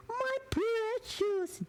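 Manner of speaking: very high, slow, strange creature voice croaking for the ring; ring-hungry creature. Retold or imitated. Tarot reader imitating the ring-craving creature, very high and slow. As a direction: stretch out my precious like a greedy monster. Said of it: "Pinagnanasaan nung parang impacto".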